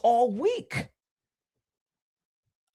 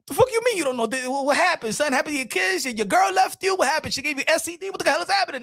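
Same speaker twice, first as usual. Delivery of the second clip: alarmed questioning; 6 semitones higher, faster than usual. Acted. Acted friend questioning the crier.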